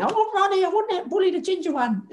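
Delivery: high falsetto impression of the cook; imitating Rita the cook. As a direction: squeak it out like the old cook. Cook Rita voiced asking for him.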